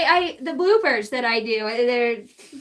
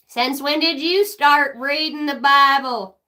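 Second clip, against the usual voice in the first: ordinary delivery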